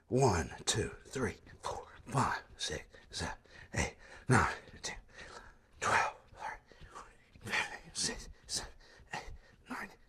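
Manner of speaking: whispered counts, short of air; out of breath mid-workout, whispering the count; breathless counting. Real, not acted. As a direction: count in a breathy whisper while working hard. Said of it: "I'm definitely out of breath".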